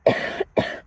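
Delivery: a sudden, unvoiced cough, held back too long; real cough. Real, not acted. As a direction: let the cough burst out after holding it in. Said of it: "I feel like I have to cough so bad".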